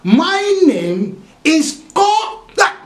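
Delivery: slow, high stalling reply; stalling over his own name; hesitant drawn-out answer. Acted. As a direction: stretch it out nervously. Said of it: "And say".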